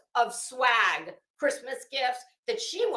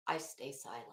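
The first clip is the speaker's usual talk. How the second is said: much softer and lower, almost under the breath; hushed; 12 semitones lower, 13 dB quieter than usual. Real, not acted. Telling viewers how she stays out of it, hushed and dropped low. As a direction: let the voice drop away to show the silence.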